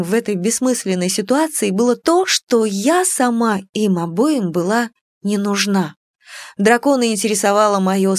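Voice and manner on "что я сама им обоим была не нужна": ordinary delivery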